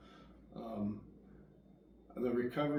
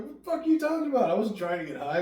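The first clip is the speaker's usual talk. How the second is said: much higher, louder and fast, outraged; retold indignant yell at the sponsor, higher and faster; indignant outburst; 11 semitones higher, 11 dB louder, faster than usual. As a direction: fire it back as a retold protest.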